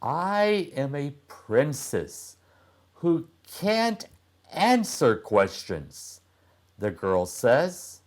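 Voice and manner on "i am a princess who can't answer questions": put-on girl's voice. Girl voiced in story.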